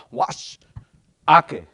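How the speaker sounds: wash, pause, then ake loud; loud ake after a short pause; with emphasis. Shown as a demonstration. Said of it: "say it first".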